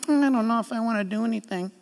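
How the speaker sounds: soft, lazy reluctant mumble. Pretend. Preacher mocking a reluctant churchgoer, soft mumble. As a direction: mumble it half-heartedly.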